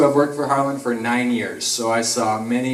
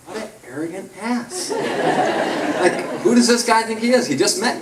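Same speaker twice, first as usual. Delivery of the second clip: laughing mock indignation; 10 semitones higher than usual. Retelling his first shift annoyance.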